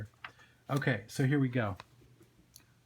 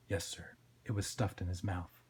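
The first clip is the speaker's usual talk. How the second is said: low, soft, hesitant report; understated, obedient sidekick; meek deputy Twitch; 6 semitones lower, 7 dB quieter than usual. Acted. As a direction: break it to the boss quietly.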